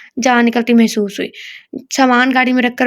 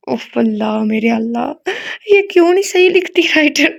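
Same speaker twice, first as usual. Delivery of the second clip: exasperated aside; 2 semitones higher than usual. Real, not acted. Reader complains about the writer.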